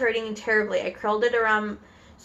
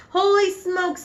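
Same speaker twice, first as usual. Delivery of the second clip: high-pitched yell of alarm; 9 semitones higher, slower than usual. Acted. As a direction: yelp it as the startled man. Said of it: "the man was yelling".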